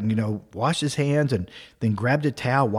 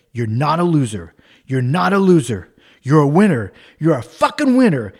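mock self pep talk, building louder; retold yelling; 5 dB louder than usual. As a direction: yell encouragement at yourself, mildly at first, then harder. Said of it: "he's yelling and slapping himself".

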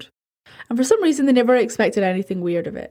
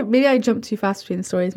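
plain reading voice

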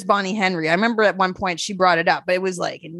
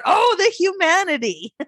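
hammed-up outcry without much volume; mock yell; 9 semitones higher than usual. Mocking someone yelling out during bad sex, mock yell. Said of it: "they're really gonna yell out".

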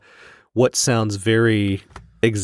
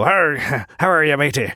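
pirate voice; 4 semitones higher than usual. Retold or imitated. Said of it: "do you think they really go".

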